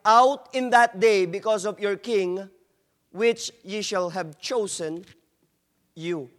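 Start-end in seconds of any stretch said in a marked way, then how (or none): none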